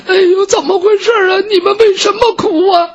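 high-pitched weepy wail; tearful maidservant. A narrator's reading. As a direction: ask it in a very high, crying maid's voice. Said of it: "他们在那抽抽搭搭的哭".

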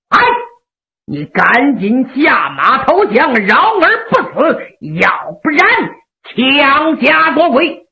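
plain reading voice

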